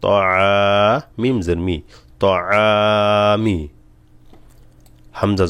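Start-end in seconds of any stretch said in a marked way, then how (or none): 0.0-0.5 short "ত", long-held "আ"; madd stretch. Shown as a demonstration. Teacher building a word for learners, stretched "আ". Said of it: "এখানে একটা টান আছে". 2.2-3.6 short "ত", long "আ", short "মি"; madd stretch. Shown as a demonstration. Teacher reading practice words for learners, stretched middle "আ". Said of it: "এখানে একটা টান আছে".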